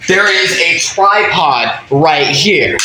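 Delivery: high, slow, drawn-out insistence; exasperated acted proclaiming; insistent exclamation. Insisting again on equipment.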